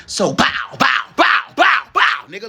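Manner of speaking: mock battle cry, rising to a shout on the second bow bow. Hyping herself up about busting through, shouted bow bow. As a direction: barrel through with a loud bow bow.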